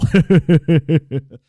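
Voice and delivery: rapid run of chuckles; host's chuckle. Acted. Host joking to caller about sister named Bill, chuckling. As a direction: chuckle at your own joke. Host laughs at own joke.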